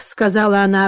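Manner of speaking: ordinary delivery, nothing marked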